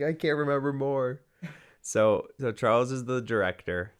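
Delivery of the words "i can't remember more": raised-pitch mock panic; overwhelmed actor's protest; freaking out. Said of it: "He's freaking out".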